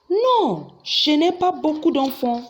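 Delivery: a high, firm no, then a steady denial; emphatic no. Shown as a demonstration. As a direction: deny it with a sharp, high-pitched no. Answering the question.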